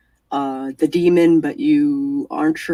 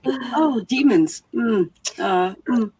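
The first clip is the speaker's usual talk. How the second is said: mock-casual, higher-pitched reply; nonchalant; 7 semitones higher than usual. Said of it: "nonchalantly said".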